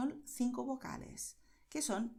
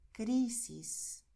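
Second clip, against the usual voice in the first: crisis with a long, strong CRI; crisis, deliberate, CRI held and SIS let go; penultimate stress. Shown as a demonstration. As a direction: punch the first syllable of crisis. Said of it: "El destaque está en la sílaba".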